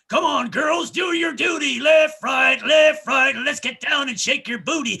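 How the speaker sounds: high, loud flamboyant marching chant; hammed-up drill call; camp drill sergeant. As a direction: call the cadence with sass. Drill sergeant cadence mocked.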